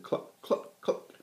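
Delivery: clucking like hens; breathy cluck. A narrator's reading. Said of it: "the hens said".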